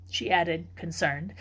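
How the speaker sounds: plain reading voice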